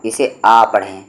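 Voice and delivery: normal talking voice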